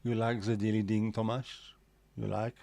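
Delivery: put-on French accent, "the" said as "ze". Shown as a demonstration. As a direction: play up the French accent on purpose. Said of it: "read that in a French accent".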